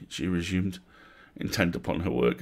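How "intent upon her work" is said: ordinary delivery, nothing marked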